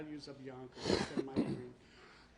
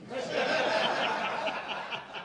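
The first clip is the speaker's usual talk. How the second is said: loud laugh; 6 semitones higher, 12 dB louder than usual. Real, not acted. Embarrassed laugh at a joke.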